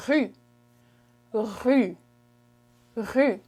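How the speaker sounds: rue with throat R and rounded French u; French pronunciation. Shown as a demonstration. Teacher shows street in French, careful isolated rue. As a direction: repeat rue carefully, one word at a time. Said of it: "A street in French is".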